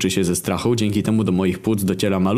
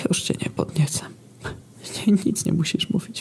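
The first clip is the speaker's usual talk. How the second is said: breathy high whimper of a hurt stepmother; tearful whisper; 7 semitones higher, 4 dB quieter than usual. A narrator's reading. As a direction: whisper it through held-back tears, wounded. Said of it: "płaczliwym głosem macocha".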